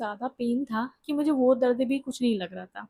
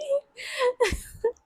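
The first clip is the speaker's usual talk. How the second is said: high wailing laugh; a helpless high laugh-cry; 12 semitones higher than usual. Real, not acted. Laughing at her own thought.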